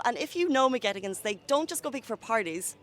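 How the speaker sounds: normal talking voice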